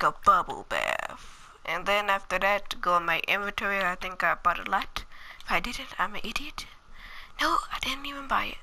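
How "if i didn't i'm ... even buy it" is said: unvoiced whisper to himself; whispered aside. Real, not acted. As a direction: whisper it under your breath, like a secret aside. Muttering to himself mid-game.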